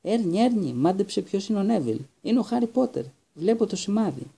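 bursting to share the news; excited. Acted. Stan telling Ernie it's Harry Potter, thrilled and high.

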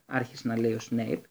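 ordinary delivery, nothing marked

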